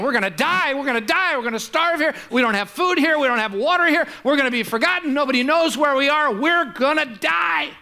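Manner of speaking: high, frantic mock panic. Castaway panicking to his friend, high frantic mock panic. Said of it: "one of them is just panicking".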